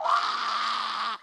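sharp short anguished cry; distressed cry. Retold or imitated. Woman reliving her guilt, a sudden anguished cry. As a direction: let out a pained "Ah!".

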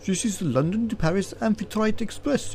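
high, patronising guard voice; condescending explanation. A narrator's reading. Guard explaining to a baffled passenger, condescending. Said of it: "as though to a particularly dim-witted child".

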